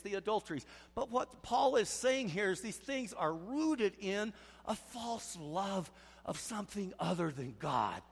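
normal talking voice

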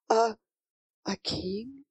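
soft, halting, awestruck question; hushed amazement. A narrator's reading. Sophie realises who her friend is, amazed and halting. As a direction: barely get the word out. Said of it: "Sophie could hardly get the word out. She was so amazed".

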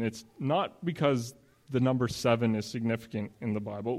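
usual speaking voice

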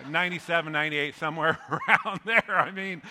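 loud, laughing through the numbers. Real, not acted. Preacher joking about huge families, laughing loudly. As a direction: chuckle through the joke.

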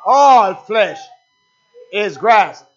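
loud call, "All" stretched long; crying out scripture. Real, not acted. Preacher asking the congregation to respond, crying out loudly. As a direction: cry it out to rouse the congregation.

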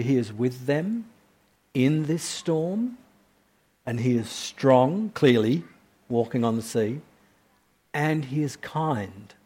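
ordinary talk, nothing marked

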